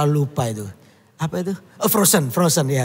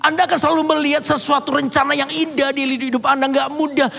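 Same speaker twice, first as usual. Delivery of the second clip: raised, fervent preacher's voice; preaching; 8 semitones higher than usual. Acted. Preacher urging the congregation to trust God, fervent. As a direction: drive the lesson home to the congregation. Preacher drives the lesson home.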